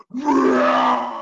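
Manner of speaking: loud roaring shout; dragon roar. Shown as a demonstration. Kids yoga teacher leading a dragon roar, loud roar. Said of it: "you're gonna go raw as loud as you want".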